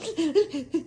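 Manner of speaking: high, hammed-up crying noise; imitated blubbering; mock sobbing. Driver breaking down after officer leaves, imitated sobs. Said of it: "the tears just start pouring down".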